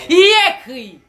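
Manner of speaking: loud call to the crowd. Acted. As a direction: call out to the audience.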